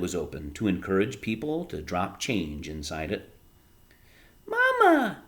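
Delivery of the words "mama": put-on much higher little kid voice; small child. A narrator's reading. Small child calling mother about the bunny, tiny high voice. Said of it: "said a small child".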